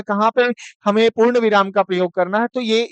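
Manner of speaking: ordinary delivery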